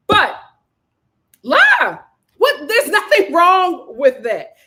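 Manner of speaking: loud, high, amused insistence; hollered advice. Pretend. Advising women to lie to heathens, amused holler. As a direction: holler it, then insist with a laugh.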